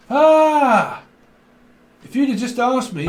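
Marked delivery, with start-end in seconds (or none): 0.1-1.0 high loud frustrated shriek; anguished scream. Retold or imitated. Worried partner losing it, high loud scream. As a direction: let out a burst of despair. Panic scream in retold worry.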